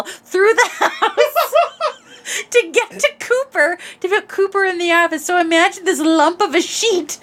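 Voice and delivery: high voice broken by laughter; laughing while telling. Real, not acted. Telling a friend about the sheet crawl, laughing throughout. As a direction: giggle through the story.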